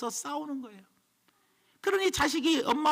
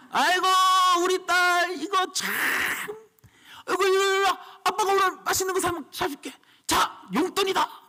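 doting father; 7 semitones higher, 3 dB louder than usual. Retold or imitated. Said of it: "내가 기분이 좋으면".

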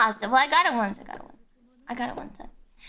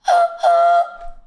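loud high startled gasp; sharp gasp; 13 semitones higher, 7 dB louder than usual. Real, not acted. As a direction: gasp in real shock. Shocked at her own sound.

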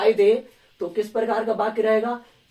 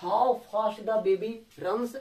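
drawn-out wondering exclamation; slow surprised reading; much slower than usual. Shown as a demonstration. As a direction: stretch it out in amazement. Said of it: "आगे देखिए".

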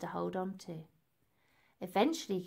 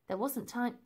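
plain reading voice

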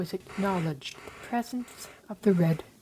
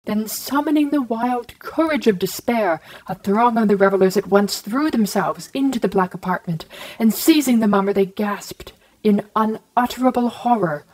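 loud, rising climax read at full force; storyteller belting out the climax; dramatic loud narration; 8 dB louder than usual. A narrator's reading. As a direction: build the panic of the revelers loudly. Climax of the horror tale.

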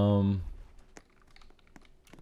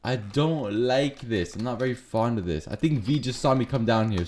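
ordinary talk

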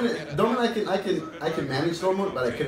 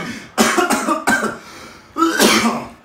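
real coughing fit; choking cough; 9 semitones higher, 4 dB louder than usual. Real, not acted. Player choking mid-match, real choking cough. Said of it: "I was choking".

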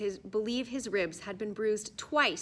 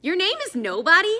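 higher, louder startled question; blurted disbelief; 10 semitones higher, 10 dB louder than usual. A narrator's reading. Raj astonished that the boy's name is Nobody. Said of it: "Raj blurted out".

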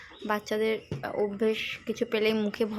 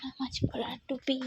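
whisper; 3 semitones higher, 5 dB quieter than usual. Real, not acted. Whispering near sleeping baby. (ঘুমন্ত শিশুর পাশে ফিসফিস করে)

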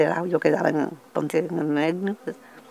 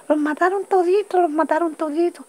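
high-pitched cry of alarm, re-enacted; imitated outcry; 12 semitones higher than usual. Musician bringing news of killings, imitated high outcry.

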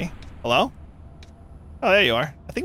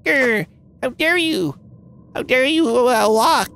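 growly, high, slow monster grumble; imitating creature; 7 semitones higher, slower than usual. Imitating the game creatures' sound, high mock growl. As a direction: ham up the creature's indignant growl. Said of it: "that's the sound they make".

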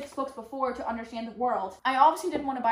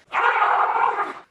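high, loud breathy scream; dramatic scream; 11 semitones higher, 8 dB louder than usual. Real, not acted. After outstretching her arm, dramatic scream. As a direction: let out an over-the-top scream. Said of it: "that was a bit dramatic".

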